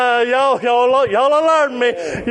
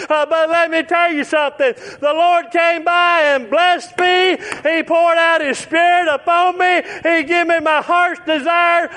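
preaching at full force; 7 semitones higher than usual. Acted. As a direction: lift it up like a fired-up country preacher. Preaching testimony to congregation.

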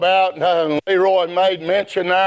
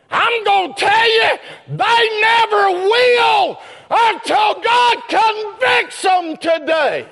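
preaching at full force; 13 semitones higher than usual. Acted. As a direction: ring it out like a revival preacher. Preacher shouts warning.

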